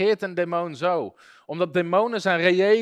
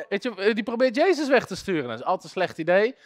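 usual speaking voice

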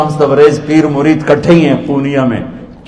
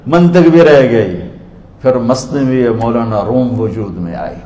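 usual speaking voice

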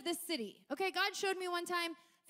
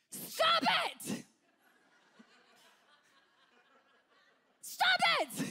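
yelled stop it; 4 dB louder, much slower than usual. Retold or imitated. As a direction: bark it at her like a fed-up counselor. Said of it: "he literally yells at him".

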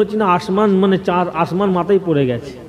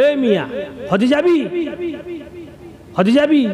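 higher, playful imitation of the teasing father; father's teasing voice; 8 semitones higher than usual. Son mimics his late father teasing a visitor, high playful. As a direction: lift the voice and tease like the old father joking. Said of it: "যেমন করে বলে আব্বা".

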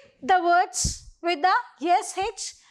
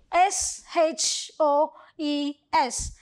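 spelling aloud; 2 semitones lower, slower than usual. Shown as a demonstration. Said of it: "let's complete the words first".

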